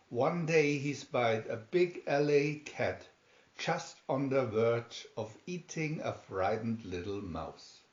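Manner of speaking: ordinary delivery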